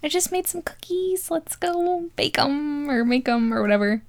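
high airy mock boyfriend offer; mock goofy guy. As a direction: chirp it like a clueless boyfriend. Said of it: "he's like".

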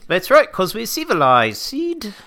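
high, loud mock British accent; a posh Brit, hammed up. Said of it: "this British accent".

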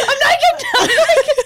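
high, loud laughing wail; mock panicked cry. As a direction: imitate her shrieking through laughter. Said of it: "Kate's going".

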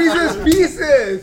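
loud, laughing shout of the candy name. Real, not acted. Friend laughs and shouts the candy name back in protest. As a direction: yell it back through a laugh.

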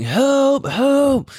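high desperate shouted help; yelling for help. Retold or imitated. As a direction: cry out for help, panicked. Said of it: "So I'm yelling".

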